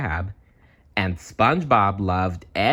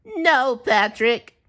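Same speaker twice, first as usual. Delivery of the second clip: very high, slow crying wail; 3 dB louder, much slower than usual. A narrator's reading. SpongeBob telling Patrick he's fired, slow crying wail. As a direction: sob out the words. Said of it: "SpongeBob wailed".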